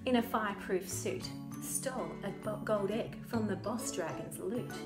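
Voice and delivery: usual speaking voice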